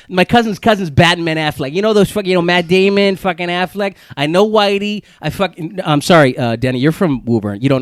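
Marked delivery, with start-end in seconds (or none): 0.1-5.8 hammed-up Boston townie accent; cocky Boston name-dropper accent. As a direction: brag like a Boston local, accent laid on. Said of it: "Can you do a Boston accent".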